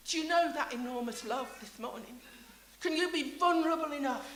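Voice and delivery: ordinary delivery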